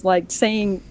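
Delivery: ordinary talk, nothing marked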